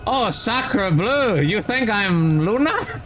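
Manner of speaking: high, slow hammed-up French accent. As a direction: ham up a French chef voice. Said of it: "speaking in, uh, perfect, a perfect Luna accent".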